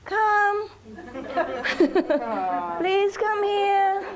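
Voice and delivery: high, slow cooing call to the dog; mock neighbor calling dog. Neighbor pretends to call her dog back, high and cooing. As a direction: coax the dog in a high fake-sweet voice.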